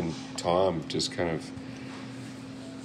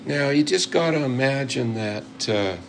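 put-on higher-pitched old American mentor; mock-American mentor voice, pitched up; American accent; 6 semitones higher than usual. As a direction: imitate a folksy American old-timer giving advice. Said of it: "I'm doing your American accent".

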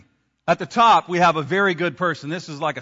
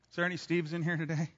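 ordinary delivery

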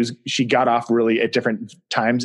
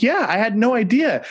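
husband's reply; 10 semitones higher than usual. Retold or imitated. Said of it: "And he's like".

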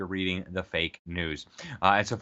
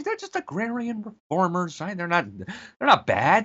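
sarcastic mock; 8 semitones higher than usual.

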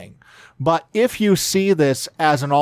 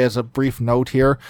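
ordinary delivery

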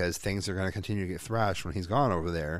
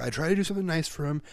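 put-on higher-pitched, hurried kid's protest; aggrieved complaint; 7 semitones higher than usual. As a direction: imitate an upset kid complaining. Retelling the boy's complaint.

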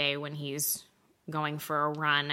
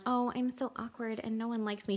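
put-on soft, higher voice of a self-pitying shy girl; mock awkward newcomer; 5 semitones higher, 6 dB quieter than usual. Podcaster parodies the shy heroine she didn't get, mock timid. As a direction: play timid and awkward, small and quiet. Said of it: "she didn't come in like".

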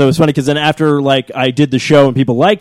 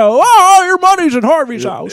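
put-on much higher, halting old-film voice; Jimmy Stewart impression; 13 semitones higher, slower than usual. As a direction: stammer it out like a film star. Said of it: "Jimmy Stewart".

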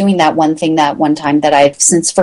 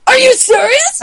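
much higher, squeaky with disbelief; amazed disbelief. Retold or imitated. Hearing a printer will print any number, much higher and incredulous. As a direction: gasp it out in happy shock.

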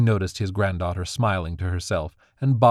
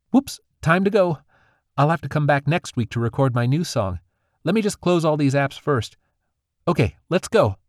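put-on higher, hurried girl's voice; a young sprite rushing off, higher; 7 semitones higher, 4 dB louder than usual. Hearing car doors, the sprite girl hurries away.

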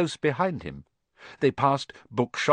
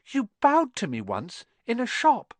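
put-on young boy voice; 11 semitones higher than usual. A narrator's reading. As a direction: say it like a timid boy. Harry speaks.

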